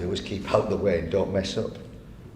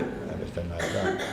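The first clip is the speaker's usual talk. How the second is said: ordinary talk, nothing marked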